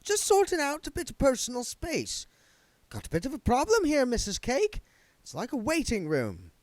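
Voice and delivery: breezy and pleased with himself; smug spirit guide. Acted.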